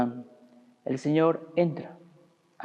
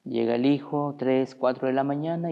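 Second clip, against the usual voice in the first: usual speaking voice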